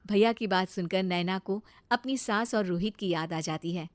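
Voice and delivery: ordinary delivery, nothing marked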